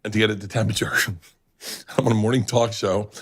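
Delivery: ordinary delivery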